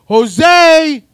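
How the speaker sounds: high, full-volume yell of a name; mock holler. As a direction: imitate bellowing for someone inside. Friend yells into the trailer.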